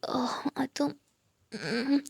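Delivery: slow drowsy whisper; sleepy whisper. Acted. Bhumi cuddling Geeta in the morning, sleepy whisper. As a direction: stretch it out half asleep.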